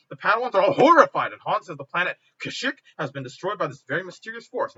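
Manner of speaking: usual speaking voice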